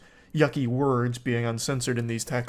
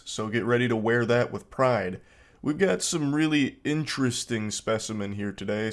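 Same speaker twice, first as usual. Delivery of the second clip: show host narration; 4 semitones lower than usual. Acted. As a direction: welcome viewers to a weird text show. Hosts a new segment.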